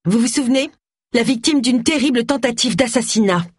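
high, showy press speech; high-pitched self-promoting declaration; Roy Silver. A narrator's reading. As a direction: announce it to the cameras in a high voice.

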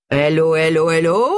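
comic's bawled catchphrase, rising at the end; bawling. A narrator's reading. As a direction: bawl it out like a stage comic. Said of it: "brailla-t-il".

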